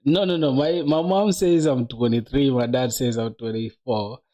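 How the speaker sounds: ordinary talk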